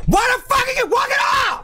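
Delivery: very high-pitched, screamed question; furious scream. Real, not acted. Gamer to Mario walking off a ledge, furious scream. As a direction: scream it in real exasperation. Raging at game character.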